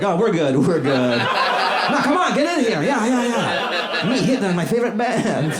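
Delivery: high, eager, insistent; pushy superfan. Retold or imitated. Hitler insisting on a photo with the band, high eager voice.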